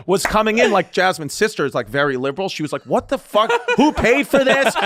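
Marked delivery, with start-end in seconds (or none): 2.8-4.7 high-pitched mock outrage; retold yelling. Sister-in-law seeing the wreath by the casket, yelling. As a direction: imitate an outraged relative, half laughing. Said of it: "they were yelling at me".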